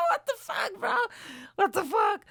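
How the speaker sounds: very high, cracking up in shock; high incredulous laugh-talk; laughing disbelief. Retold or imitated. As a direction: squeal it through helpless laughter. Retold shock at driver saying si.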